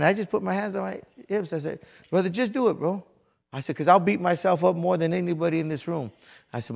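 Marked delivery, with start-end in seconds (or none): 2.1-3.1 high, loud exasperated insistence; mad retold plea. Trainee with hands on hips to his teacher, mad. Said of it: "And I was mad". 3.8-6.1 higher, louder self-reproach; retold frustration. Trainee explaining his outburst to his teacher, frustrated. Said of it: "And I was mad".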